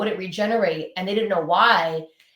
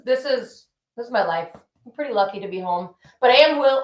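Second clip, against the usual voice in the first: usual speaking voice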